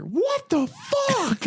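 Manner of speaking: very high, slow, drawn-out squeal of shock; Ted voice. Retold or imitated. Ted discovers the hidden porn files, high shocked squeal. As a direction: squeak it out like a stunned teddy bear. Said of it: "And you just hear".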